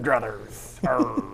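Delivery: low pirate growl. Pretend. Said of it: "pirate term".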